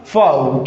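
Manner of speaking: फाव as a single falling "faav"; correct pronunciation. Shown as a demonstration. Teacher introducing a word to students, फाव as one low syllable. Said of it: "इसका correct pronunciation क्या हो जाएगा".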